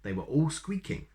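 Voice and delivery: ordinary delivery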